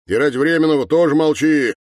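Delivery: fast, high, forceful order; loud silencing. Acted. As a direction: shut him up loudly and quickly. Captain silences Sergei.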